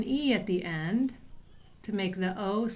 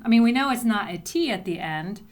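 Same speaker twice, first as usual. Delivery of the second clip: usual speaking voice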